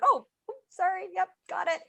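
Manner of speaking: sheepish apology. Retold or imitated. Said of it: "So I'm like".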